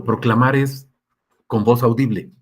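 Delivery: ordinary talk, nothing marked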